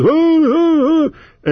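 high, breathy swooning mock-orator "oh"s; mocking Kerry's oratory. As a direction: ham up a pompous speechmaker swooning at his own words. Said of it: "they get off on this".

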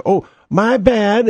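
high, slow fake oops; mock apology. Crooked business caught stealing, slow high fake oops. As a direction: stretch out a phony sorry. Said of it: "and they say".

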